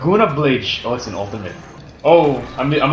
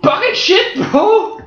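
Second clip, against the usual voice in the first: high exclamation; 14 semitones higher, slower than usual. Real, not acted. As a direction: yelp it out at the screen. Gamer exclaiming in match.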